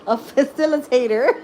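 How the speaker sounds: the word facilitator said high and loud through giggles; laughing. Real, not acted. To viewers, finally saying facilitator while laughing.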